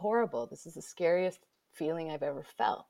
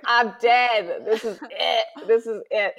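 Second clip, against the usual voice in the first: mock despair; 6 semitones higher, 10 dB louder than usual. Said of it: "I was like".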